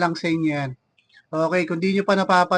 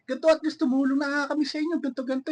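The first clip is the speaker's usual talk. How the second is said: higher, fast, rattled-off mock complaint; fast mocking parody; 7 semitones higher, much faster than usual. Vlogger mimics helpers who brag about helping, fast and higher mock voice. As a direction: parrot them quickly and scornfully.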